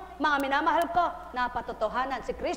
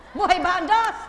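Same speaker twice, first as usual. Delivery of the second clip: high, loud rallying cry; loud preaching call; 6 semitones higher, 9 dB louder than usual. Acted. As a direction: rouse the congregation. Preacher calling to crowd.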